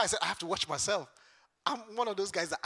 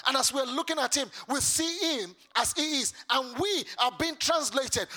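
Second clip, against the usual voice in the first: raised, fervent sermon delivery, pushing each phrase; full-force preaching; 6 semitones higher, 5 dB louder than usual. Acted. Preacher challenging the congregation to serve, fervent preaching. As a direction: preach it hard to the whole room.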